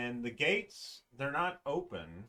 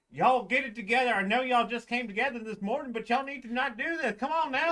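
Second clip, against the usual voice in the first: higher, quick, exasperated scolding; scolding guard; 11 semitones higher, 6 dB louder, faster than usual. Acted. Guard scolding two fighting golems, fast and exasperated. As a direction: hurry in to break up the fight. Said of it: "it's like".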